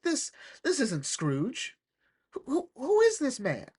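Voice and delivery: mock disbelief, pitch leaping up; acted baffled outrage, high; incredulous. Acted outraged reaction to Scrooge.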